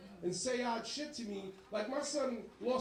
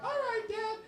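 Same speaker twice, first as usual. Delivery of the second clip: put-on high, boyish son voice; son's reply; 11 semitones higher than usual. Comic answering his dad in a high boyish squeak. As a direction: squeak it like a kid.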